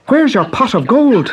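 put-on high, eager young Irish boy; bright boyish Irish lad; Pat's boy voice. A narrator's reading. Pat demands gold.

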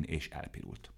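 usual speaking voice

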